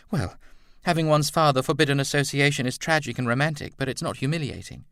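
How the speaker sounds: high, quick, assured woman; Edna's voice. A narrator's reading. Edna consoling Roland about Lucy, high and quick. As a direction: explain it briskly in a high, light voice.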